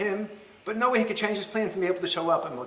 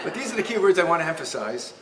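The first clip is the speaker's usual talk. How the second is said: ordinary talk, nothing marked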